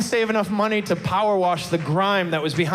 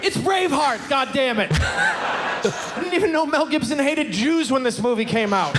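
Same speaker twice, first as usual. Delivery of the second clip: shouted rant; 7 semitones higher than usual. Real, not acted.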